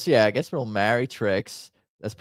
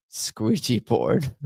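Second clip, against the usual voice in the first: laughing; 2 semitones higher, much slower than usual. Real, not acted. Laughing at own mispronunciation.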